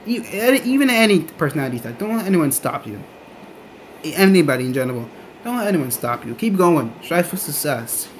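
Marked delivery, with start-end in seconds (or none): none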